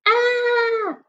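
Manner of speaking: high frightened "Ah!"; scared yelp. Acted. Teacher voicing a player scared of the ball, high yelp. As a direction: yelp like a kid afraid of the ball. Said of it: "He's afraid of the ball".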